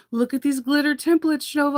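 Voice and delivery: tempting her like a kidnapper with a treat; mock lure. Kidnapper luring her with glitter templates, sly tempting voice. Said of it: "they'll be like".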